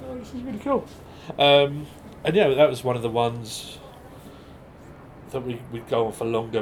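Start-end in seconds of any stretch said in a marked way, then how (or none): 0.1-0.9 high bright host voice. Retold or imitated. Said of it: "it was just like".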